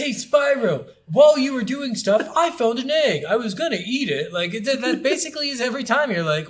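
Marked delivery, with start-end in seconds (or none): 0.0-4.3 high, louder goofy character voice; silly game character. Pretend. Mocking a game character telling Spyro about the egg, high goofy voice. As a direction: play a dopey NPC bragging to the hero.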